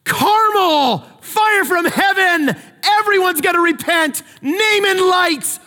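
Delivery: thrilled shout. Retold or imitated. Preacher voicing Elijah's hoped-for revival, high and shouting. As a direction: proclaim the big show.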